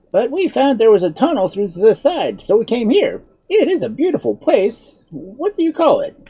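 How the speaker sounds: high-pitched, animated wizard; explaining wizard. A narrator's reading. Wizard explaining their fall to the woman, animated. Wizard continues his account.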